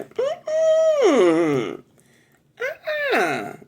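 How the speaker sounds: loud, very high "mm-mm" sliding down; high, emphatic auntie refusal noises; sounding like auntie. Real, not acted. Reader channeling auntie's energy, loud high auntie-style refusal. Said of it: "Got me sounding like my auntie".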